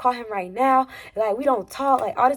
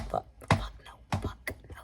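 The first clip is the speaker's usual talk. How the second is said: whispered, much softer swearing; whispered refusal; 11 dB quieter than usual. Real, not acted. Storyteller reacting to her own memory, whispered refusal. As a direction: whisper it to yourself in disbelief.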